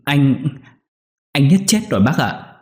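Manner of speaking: high, loud, tearful rush; choked sobbing. Acted. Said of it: "Tiếng vợ thằng Nhất nấc nghẹn lại vang lên".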